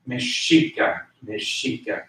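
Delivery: Mexica as meh-SHEE-ka. Shown as a demonstration. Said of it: "pronouncing the X as an S H".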